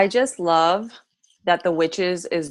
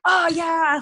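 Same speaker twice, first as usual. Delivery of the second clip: excited exclamation; 11 semitones higher than usual. Retold or imitated. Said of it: "And I'm like".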